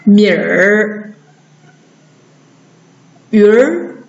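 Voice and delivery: 米儿, 鱼儿 singly, vowel gliding into r; teacher modelling 米儿 and 鱼儿 to repeat; erhua demo. Shown as a demonstration. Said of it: "来，跟着老师读".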